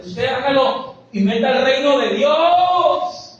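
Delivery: rousing pulpit cry; full-force preaching. Real, not acted. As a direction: drive it home to the congregation, stretching the last word. Preacher bursts into full-force exhortation.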